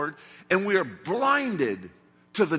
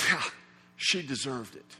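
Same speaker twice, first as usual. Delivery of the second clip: smug aside; 8 semitones lower, 8 dB quieter than usual. Retold or imitated. Disciples voiced, smug agreement.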